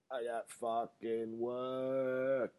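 high, very slow complaint; drawn-out mock lament. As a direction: stretch it out high and slow, hamming up the misery. Exaggerated repeat about having work.

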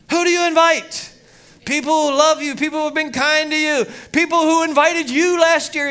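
loud, high preacher's build; preaching at full force. Acted. Pastor preaching hard to the congregation, loud and high.

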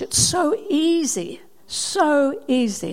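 drawn out slowly; slow emphasis. Acted. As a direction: lean on each word, slowly. Preacher repeats slowly for emphasis.

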